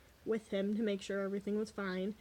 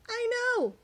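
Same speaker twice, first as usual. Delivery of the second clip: high, louder exclamation of agreement; emphatic agreement; 12 semitones higher, 5 dB louder than usual. Retold or imitated. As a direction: blurt it out high and loud, wholeheartedly agreeing. Agreeing heartily in retold call.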